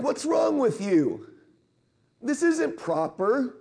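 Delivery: high and slow, scandalised; indignant disciples. Retold or imitated. Disciples scolding the parents, slow indignant disapproval. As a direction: look down your nose as you say it. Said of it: "and they're like".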